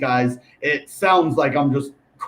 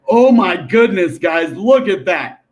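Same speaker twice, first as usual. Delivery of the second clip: oh my goodness louder and higher, look at that slowed; excited surprise; 3 semitones higher, 4 dB louder than usual. Real, not acted. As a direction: burst out thrilled at the chart. Chart hits price target.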